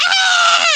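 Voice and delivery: high, loud mock shriek from the woods; imitated scream. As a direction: let out the scream you heard through the trees. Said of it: "we hear this scream".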